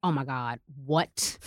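normal talking voice

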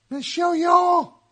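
ordinary talk, nothing marked

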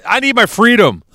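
loud, comic indignant rant; hammed-up protest, building to a shout; mock outrage. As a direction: rant like a wronged man demanding freedom. Mock outrage about YouTube censorship.

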